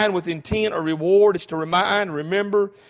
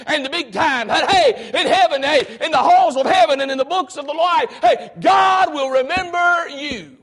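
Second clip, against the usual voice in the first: preaching climax; 6 semitones higher than usual. Acted. Preacher shouts the promise of heaven to the congregation. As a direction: bring it home to the church.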